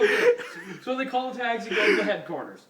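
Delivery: ordinary talk, nothing marked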